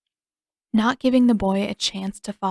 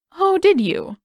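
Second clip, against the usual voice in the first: bright, louder mother greeting son; mother's voice; 10 semitones higher, 8 dB louder than usual. A narrator's reading. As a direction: greet him pleasantly.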